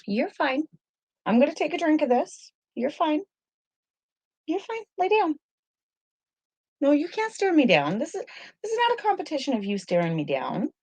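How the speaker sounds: very high sing-song dog talk; pet talk. Real, not acted. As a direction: coo firmly at the dog to lie down. Talking to her dog.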